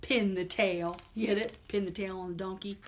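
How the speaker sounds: put-on lively read-aloud to children; storytime reading. Teacher reading a picture book to kids, lively storytime voice. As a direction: read it brightly to little listeners.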